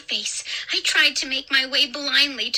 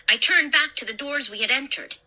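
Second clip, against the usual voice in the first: ordinary delivery, nothing marked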